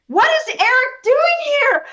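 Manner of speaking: high, slow astonished question; surprised. Real, not acted. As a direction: gasp at a sudden arrival. Surprise guest appears.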